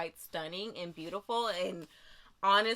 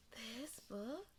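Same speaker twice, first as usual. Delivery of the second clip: soft unvoiced whisper; a hushed, secret aside; whispered question; 16 dB quieter than usual. Real, not acted. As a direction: whisper it to yourself. Hushed peek inside.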